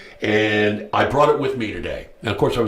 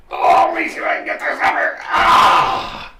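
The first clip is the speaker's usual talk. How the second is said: straining; 4 dB louder than usual. Real, not acted. Lifting heavy rifle up.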